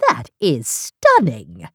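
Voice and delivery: low slow awed voice. Acted. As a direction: breathe it out slowly, awestruck. Nancy admiring the ring.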